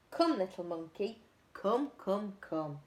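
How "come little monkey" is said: ordinary delivery, nothing marked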